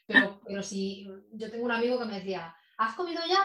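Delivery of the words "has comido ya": high, louder teasing question from a friend; mock friend's question. Friend teasing her about being hungry, high playful question. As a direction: toss it off like a caring friend checking in. Friend asking if she ate.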